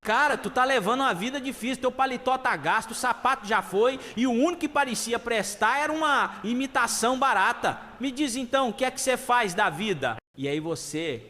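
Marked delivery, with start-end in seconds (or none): 0.0-10.1 high-pitched, exasperated scolding; fed-up robber, high and insistent; disenchanted robber. Acted. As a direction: pity and reproach a hopeless victim, pitched up. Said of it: "cada vez mais desencantado".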